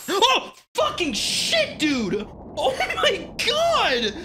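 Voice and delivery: high, slow, loud frightened scream; scared shriek. Real, not acted. Jump scare from a bass.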